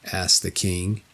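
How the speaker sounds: ordinary delivery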